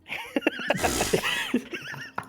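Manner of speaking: shrill ape-like shriek laugh; high squealing laugh. Real, not acted. Chimp joke laugh.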